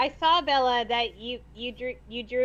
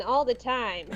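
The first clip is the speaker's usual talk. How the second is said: normal talking voice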